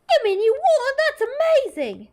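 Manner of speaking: high, slow, gushing amazement; amazed. Acted. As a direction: gush with wide-eyed awe.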